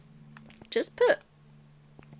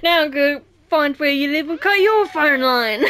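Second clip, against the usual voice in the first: mock menacing threat; 4 semitones higher, 5 dB louder than usual.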